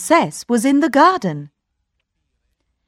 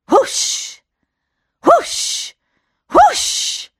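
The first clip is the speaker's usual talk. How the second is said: whispered whoosh climbing higher; magic whooshing; rising magic whoosh; much slower than usual. Acted. Genie appears.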